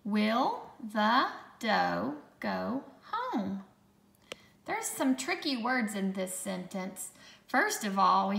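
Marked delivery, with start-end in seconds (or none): 0.0-3.6 slow, word-by-word dictation; slow, clear dictation voice; dictation pace. Shown as a demonstration. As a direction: read it out slowly for spelling. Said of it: "Let's take a look".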